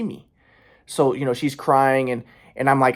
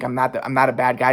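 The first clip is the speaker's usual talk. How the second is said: ordinary talk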